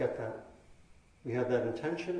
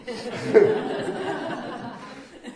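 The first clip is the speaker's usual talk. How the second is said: high airy laugh bursting out; loud breathy laugh; burst of laughter; 12 semitones higher, 8 dB louder than usual. Real, not acted. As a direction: laugh openly at your own joke. Laughing at mother joke.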